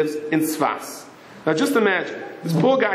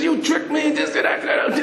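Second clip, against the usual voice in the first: mock screaming driver; 10 semitones higher than usual. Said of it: "the driver starts screaming".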